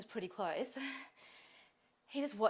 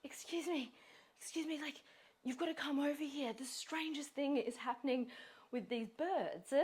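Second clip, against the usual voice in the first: a light, higher voice for the odd stranger; strange man calling; 6 semitones higher than usual. Retold or imitated. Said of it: "He's like".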